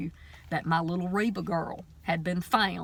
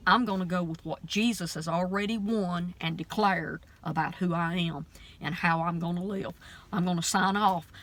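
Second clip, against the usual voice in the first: ordinary delivery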